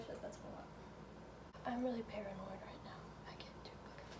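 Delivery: hushed paranoid whisper. Real, not acted. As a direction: whisper it, nervous. Whispering, scared in car.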